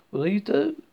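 lightly voiced Southern woman; friendly Southern accent. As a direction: offer the scissors in a Southern lilt. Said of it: "speaking in a friendly southern accent".

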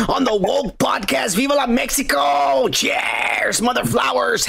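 high, excited cheer; hyped-up shout-out. Acted. Co-host reading a viewer's message, hyped-up high shout. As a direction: build it up into a rallying cheer.

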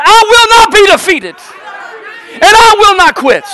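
very high, full-force rallying cry; peak-intensity pulpit chant; shouted like you mean it. Shown as a demonstration. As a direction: shout it with total conviction. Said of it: "Say it like you mean it".

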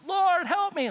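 high, loud cry for help; acted desperate appeal; calling out. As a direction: call out pleadingly as a beggar would. Blind man calls to Jesus.

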